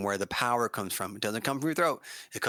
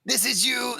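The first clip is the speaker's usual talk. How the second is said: high, louder imitation of the student; imitating student; 12 semitones higher, 5 dB louder than usual. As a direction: mimic the student's scream. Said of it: "since you're like".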